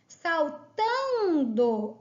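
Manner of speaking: "Saltando" slow, TAN stretched long; stress on TAN. Shown as a demonstration. Teacher showing the tonic syllable, TAN drawn out in "Saltando". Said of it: "é o tan".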